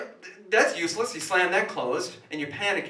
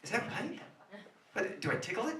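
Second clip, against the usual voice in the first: ordinary delivery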